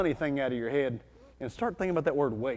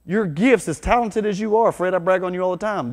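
loud preaching; 3 semitones higher, 9 dB louder than usual. Real, not acted. Preaching peak.

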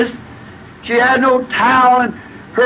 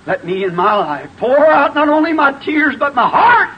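high, fervent pulpit cry; impassioned preaching; 5 semitones higher than usual. Real, not acted. Preacher vowing to the congregation to pour out his life, fervent. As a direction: build to a raised, pleading shout.

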